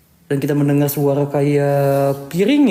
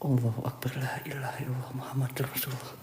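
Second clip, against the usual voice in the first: fast hushed prayer; whispered prayer; 4 semitones lower, 16 dB quieter than usual. Real, not acted. Scared prayer in haunted house.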